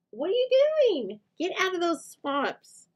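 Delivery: rising mock-outraged pet voice. Real, not acted. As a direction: coo at the impatient dog in a high voice. Scolding the dog in the swamps.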